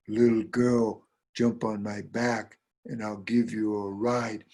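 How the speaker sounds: slow, ponderous cow voice. Acted. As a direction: draw the words out heavily. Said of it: "who shows up but the cow".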